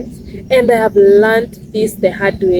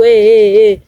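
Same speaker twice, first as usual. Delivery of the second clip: imitating a tout yelling "Hey, hey, hey" a bit louder; mock shouting tout; 5 dB louder than usual. Tout shouting at commuter to grab attention, mock yell. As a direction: mimic the tout shouting after you. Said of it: "Just be there shouting at you".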